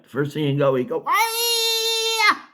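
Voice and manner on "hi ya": shrill high shouted umpire cry; high umpire yell. Retold or imitated. Umpire yelling at a kid who took strike three, high shout. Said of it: "He'd scream out".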